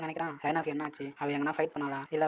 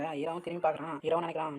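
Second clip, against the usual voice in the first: ordinary delivery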